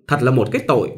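usual speaking voice

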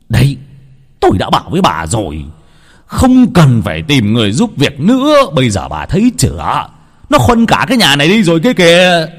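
fast, rising to a loud high yell; scolding rant. Acted. Husband blaming wife for hiring the maid, rising angry scolding. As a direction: berate her, building to a shout.

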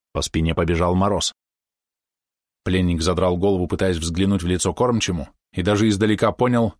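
ordinary delivery, nothing marked

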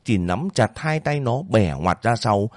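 usual speaking voice

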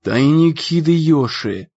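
slow, awed pronouncement; hushed awe without a whisper; reverent. A narrator's reading. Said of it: "с благоговением произнёс".

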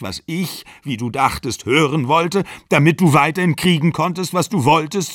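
acted angry reproach in a breakup; heated accusation. A narrator's reading.

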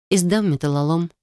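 plain reading voice

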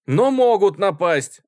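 loud, high, drawn-out protest; exclaiming. A narrator's reading. Schoolboy defending the army, exclaiming. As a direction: cry out the objection. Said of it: "воскликнул хорошенький гимназист".

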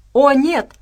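high, loud frightened cry; loud, horrified wail; cry of dismay. A narrator's reading. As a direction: cry out in sudden alarm. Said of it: "вскрикнул Хендер".

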